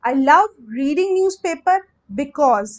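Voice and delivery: each word spoken slowly and clearly, as if for copying down; slow, deliberate model sentence; slow dictation. Shown as a demonstration. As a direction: spell it out slowly for the class. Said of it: "आप सब कैसे लिखेंगे?".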